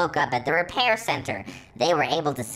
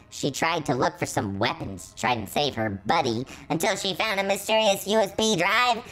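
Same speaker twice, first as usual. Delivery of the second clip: plain reading voice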